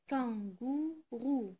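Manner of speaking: "kangourou" very slow, in three separate syllables. Shown as a demonstration. Teacher repeating for pupils, "kangourou" slowly sounded out. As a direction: pull "kangourou" apart, kan, gou, rou. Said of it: "Je répète".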